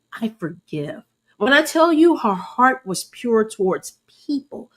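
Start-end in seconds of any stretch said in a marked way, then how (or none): 0.1-1.0 I forgive said very softly; soft quiet quote. Retold or imitated. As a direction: hush it, gently. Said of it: "She's like".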